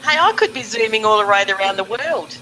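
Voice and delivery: ordinary talk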